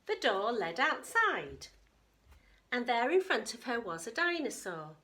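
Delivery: plain reading voice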